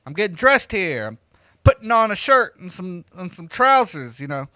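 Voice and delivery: yelling through the door; calling out. Retold or imitated. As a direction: call out to be heard through the door. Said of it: "So I call out to her".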